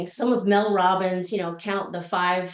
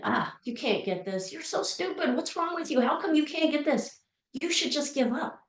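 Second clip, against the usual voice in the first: mean inner voice; 5 semitones higher, 4 dB quieter than usual. Retold or imitated. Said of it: "that little mind started chiming in".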